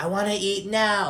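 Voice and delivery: imitated little boy insisting; mock demanding kid. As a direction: drag it out like a pestering kid. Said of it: "kind of annoying or whatever".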